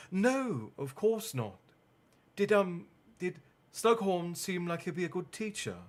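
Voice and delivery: a hint of forced brightness; nervous Hermione.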